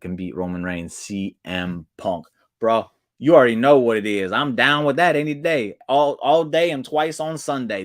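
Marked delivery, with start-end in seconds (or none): 2.6-7.8 excited. Real, not acted. Cheering idea of CM Punk.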